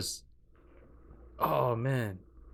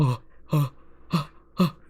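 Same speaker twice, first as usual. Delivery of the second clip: higher, louder imitated moans, hammed up; mock moaning; 7 semitones higher, 7 dB louder than usual. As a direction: playfully fake a few moans. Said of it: "I thought he was gonna be like".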